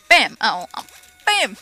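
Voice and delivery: loud, high gleeful bam with each hit; playful battle cries; excited shouts of bam. Real, not acted. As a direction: yell it out as every punch lands. Punching enemies in the game.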